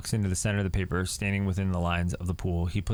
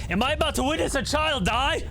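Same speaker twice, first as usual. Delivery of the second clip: high, horrified; a sudden high cry of horror; alarmed. Real, not acted. Player watching the kid walk toward fire, alarmed.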